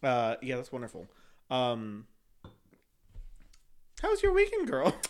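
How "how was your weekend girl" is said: very high, squeaky chatty question; mock girly small talk. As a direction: squeal it like an over-keen girlfriend.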